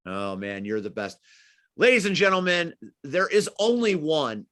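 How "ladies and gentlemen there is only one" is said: announcer. Acted. Host closing the show for the audience, announcer voice. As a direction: sell it like a ring announcer.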